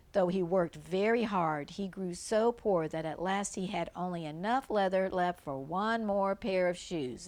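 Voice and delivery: ordinary delivery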